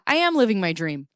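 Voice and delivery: normal talking voice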